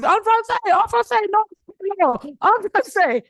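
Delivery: high, loud insistent professor voice; imitating professor. Student mimics her French professor, high and insistent. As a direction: mimic a pushy teacher correcting you. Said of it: "my professor was always".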